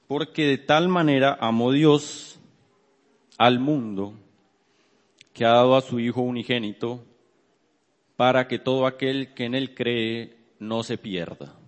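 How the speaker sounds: loud, deliberate Bible reading in separated phrases; scripture reading. Real, not acted. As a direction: proclaim the verse, pausing after each phrase. Reads scripture aloud to congregation.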